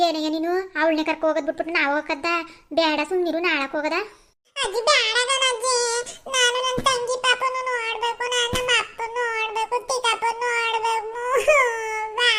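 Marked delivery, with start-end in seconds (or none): 4.5-12.3 put-on whining child voice. Child whines against grandma.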